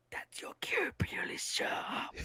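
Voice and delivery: hushed voiceless prompt from the wings; stage whisper. Retold or imitated. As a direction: whisper the cue like an offstage prompter. Offstage prompt from the side.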